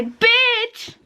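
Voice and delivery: lance un bitch aigu et fort; cri aigu. Real, not acted. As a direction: crie le mot d'un coup, haut perché. Crie bitch pour le défi. (cri perçant et joueur)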